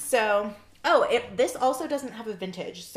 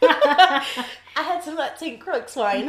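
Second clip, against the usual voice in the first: put-on "Saint Croix" said in a vague foreign accent, a bit higher; silly mystery accent; 5 semitones higher, 9 dB louder than usual. Joking at the tasting about the Saint Croix wine, silly mystery accent. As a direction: ham up an unknown accent on the wine name. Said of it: "I don't know what accent that was".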